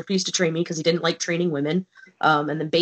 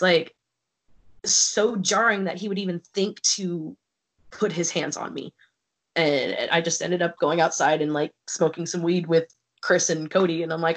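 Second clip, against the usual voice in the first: normal talking voice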